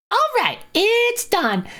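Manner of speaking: high, drawn-out announcement; lightly voiced game character. Dylan returning to the group, high drawn-out announcement. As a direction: stretch it out high and slow.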